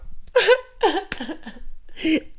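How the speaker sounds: giddy high-pitched laugh; squealing fan laugh; high giggle. Real, not acted. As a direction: burst into a delighted giggle. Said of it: "I love Ronaldo so much".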